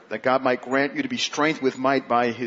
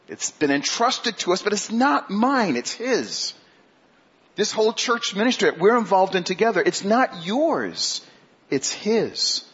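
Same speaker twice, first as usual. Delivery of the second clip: rising emphasis; 7 semitones higher, 3 dB louder than usual. Acted. Climax of sermon point.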